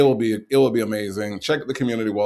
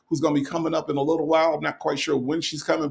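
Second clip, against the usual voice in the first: normal talking voice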